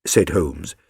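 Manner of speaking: ordinary delivery, nothing marked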